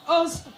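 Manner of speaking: harsh repeated coughs; coughing fit. Real, not acted. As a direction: cough hard, several times. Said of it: "excuse me".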